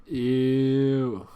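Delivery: loud, sharp ew of disgust. Real, not acted. Player recoils at a bad card draw, loud disgusted ew.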